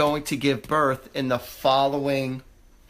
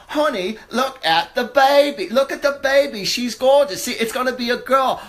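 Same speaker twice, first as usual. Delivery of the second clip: sudden excited coo over a newborn; excited gushing; 8 semitones higher, 5 dB louder than usual. Real, not acted. Medium gushing over a foreseen baby.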